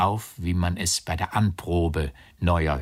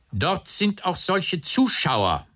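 slow, high-pitched calling out; raised, indignant cry; loud call; 11 semitones higher, slower than usual. A narrator's reading. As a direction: call it across the room, drawn out. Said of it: "ganz laut dem Aufseher zu".

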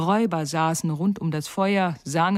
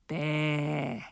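mocking bleat; 2 semitones lower, 6 dB quieter than usual. Robber girl mocking reindeer.

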